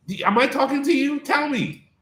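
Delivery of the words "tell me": ordinary talk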